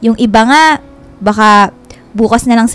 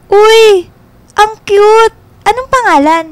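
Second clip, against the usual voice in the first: much higher, louder gushing over a puppy; delighted squeal; 10 semitones higher, 4 dB louder, slower than usual. Acted. [name] sees Anton's dog, delighted high squeal. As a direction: coo at the cute dog.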